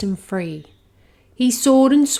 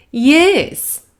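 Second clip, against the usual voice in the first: high, loud teacher dragon; a booming schoolmistress dragon calling from the sky; Madam Dragon; 7 semitones higher, 10 dB louder than usual. Acted.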